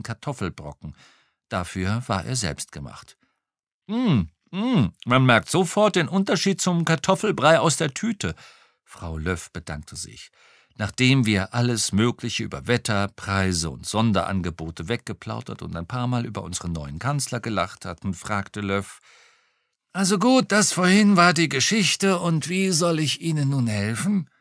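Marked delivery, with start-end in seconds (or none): 3.9-8.3 mock praise. A narrator's reading. 19.9-24.3 put-on lightly raised, chatty old cop; a hint of a gossiping retired policeman. A narrator's reading. Retired cop chatting to the detective over lunch, lightly voiced.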